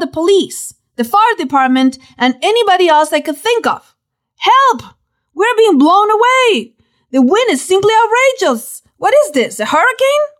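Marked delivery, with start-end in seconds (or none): 4.4-10.3 high-pitched panicked plea, read aloud; terrified. A narrator's reading. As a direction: narrate a frantic emergency call, pitch jumping up. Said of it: "Completely terrified and out of breath".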